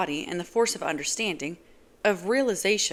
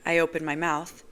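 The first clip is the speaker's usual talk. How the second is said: plain reading voice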